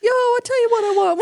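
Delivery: put-on high, loud send-up of a failed Spanish accent; teasing imitation of a bad Spanish accent; mocking bad accent. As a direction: belt out a silly mangled accent. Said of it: "that's all I heard when you said that".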